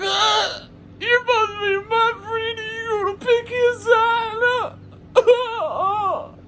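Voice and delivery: high, slow blubbering whine; drunk crying whine. Retold or imitated. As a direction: whine it through fake tears like a betrayed drunk. Drunk friend crying betrayed.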